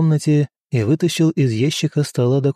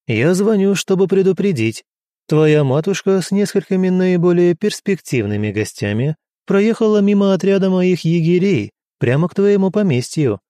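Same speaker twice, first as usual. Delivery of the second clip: high, honeyed purr; 6 semitones higher, 3 dB louder than usual. A narrator's reading. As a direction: purr down the phone with mock friendliness. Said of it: "промурлыкал я в трубку".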